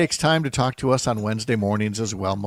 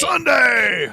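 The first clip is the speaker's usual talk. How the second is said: big promo shout, higher; hype shout; 10 semitones higher, 4 dB louder than usual. Pretend. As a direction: belt it like a truck-rally ad. Hyping a monster-truck-style promo.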